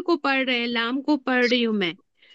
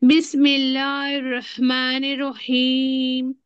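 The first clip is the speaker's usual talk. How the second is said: Quran recitation. Shown as a demonstration. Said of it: "अभी देखो".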